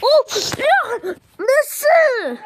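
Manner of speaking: high, loud disbelieving yelp; outraged cry. Real, not acted. Gamer after a sudden loss, high loud outcry. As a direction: shriek it in disbelief. Losing again in game.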